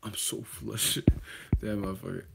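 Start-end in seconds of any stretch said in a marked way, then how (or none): 0.0-1.0 slow, soft sulky mutter; mock flustered fan. Mocking Wilder flustered over nachos, soft and slow. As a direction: sigh it out like a pouting fan.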